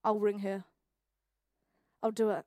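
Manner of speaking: low, soft reassurance, acted out; calming mum. Acts out mum calming her.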